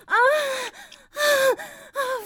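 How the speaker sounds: drawn-out high moaning; high moans. Acted. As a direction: moan high and long. Moaning at the climax.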